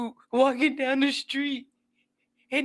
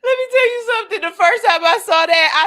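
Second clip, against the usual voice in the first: ordinary talk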